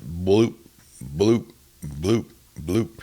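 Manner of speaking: imitated water bloop. A narrator's reading. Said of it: "that sound come again".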